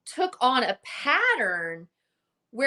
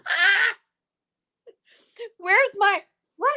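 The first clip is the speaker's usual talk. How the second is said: high, laughing startled cry; 11 semitones higher, 4 dB louder than usual. Real, not acted. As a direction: caught off guard, laughing and yelping. Ring light suddenly goes out.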